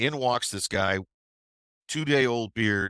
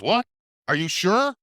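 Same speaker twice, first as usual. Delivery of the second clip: high, loud disbelief; mock surprise; 10 semitones higher, 5 dB louder than usual. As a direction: sound alarmed at the fake news, high and loud. Fake call, surprised.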